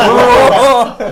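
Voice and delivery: sudden loud high boo; spooky boo. Pretend. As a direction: jump out and shout boo. (grito de susto fuerte y agudo)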